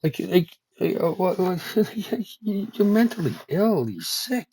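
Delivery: high, loud exasperated rant at the system; furious high rant. Real, not acted. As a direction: rant at them, voice climbing high. Angry rant at financial system.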